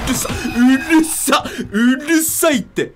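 大きく高い声でゆっくり抗議; 騒音に笑い交じりで文句; 抗議の声. Real, not acted. ゲームの音に抗議. (大声でゆっくり文句)

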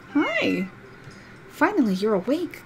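normal talking voice

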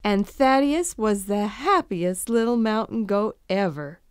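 ordinary delivery

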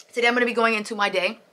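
rushed, words tumbling out; very fast. Shown as a demonstration. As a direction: race through the line quickly. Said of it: "Okay, that was very fast".

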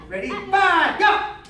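loud, high called count; drill command. Real, not acted. Coach calls the fourth strike, loud command. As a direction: fire off the count to the class.

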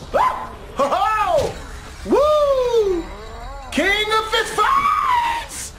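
high, drawn-out victory shout; triumphant whooping. Real, not acted. Gamer whooping after winning the fight. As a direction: crow over the win.

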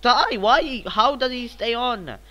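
high, loud cry of exasperation; frustrated outburst. Real, not acted. Gamer protesting at an opponent who stays on, exasperated.

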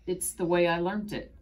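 hesitant false start, then pushing on; nervous little stammer. A narrator's reading. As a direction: stumble on the first word, then get it out. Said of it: "I stammered".

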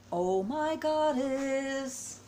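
slow, high, drawn-out amazement; awed exclamation. Real, not acted. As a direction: stretch it out high, overwhelmed by the gift. Overwhelmed on opening gift.

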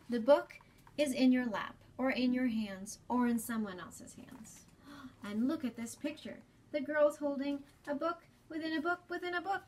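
put-on gentle storybook reading to young listeners; reading to children. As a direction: read it like story time for kids. Reads picture book aloud to children.

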